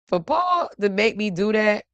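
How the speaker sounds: normal talking voice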